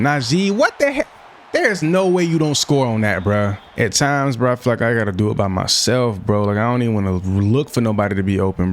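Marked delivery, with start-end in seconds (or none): 0.0-3.2 frustrated outburst. Real, not acted. Teammate turns around when open.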